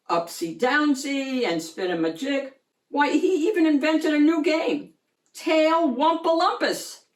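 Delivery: ordinary delivery, nothing marked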